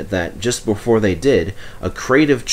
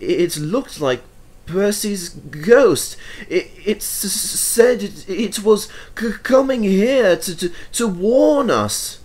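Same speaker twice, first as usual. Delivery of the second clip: frightened stammer, slow and broken; Toby tells Thomas he saw a ghost, stammering in fright; shaky, stammering voice; 10 semitones higher, slower than usual. A narrator's reading. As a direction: stumble over the words, scared of a ghost. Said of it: "said Toby in a shaky voice".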